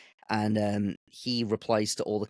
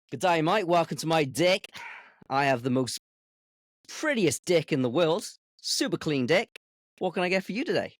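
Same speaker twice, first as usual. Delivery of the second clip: put-on Australian accent; 6 semitones higher than usual. A chef dared to serve the deck in a put-on Australian accent. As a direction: ham up an Aussie waiter greeting. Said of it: "So, Australian".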